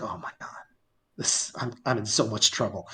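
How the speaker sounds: hushed, low sinking realization; retold dread. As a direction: mutter it low and halting, as trouble dawns. Retold dread on seeing second trailer.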